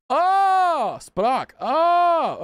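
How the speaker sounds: surprised oh. Real, not acted. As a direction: it suddenly clicks, exclaim it. Sudden realization in menu.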